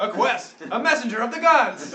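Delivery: high, very loud zealous cry; hammed-up, shouted jubilation; excited proclamation. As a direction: hail it like a sign from heaven. Player acts zealous hail of messenger.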